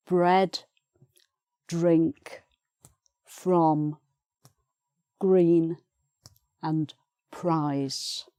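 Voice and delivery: "bread, drink, from, green, prize" with the R blended into the first consonant; teacher's word list with clearly sounded R; R sounded after consonant. Shown as a demonstration. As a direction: say each word separately, R pronounced right after the first consonant. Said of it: "has to be pronounced there in combination".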